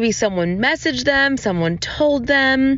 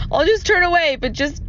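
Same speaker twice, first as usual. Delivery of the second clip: pleading through laughter, higher; laughing plea; 6 semitones higher than usual. Retold or imitated. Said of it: "I was like".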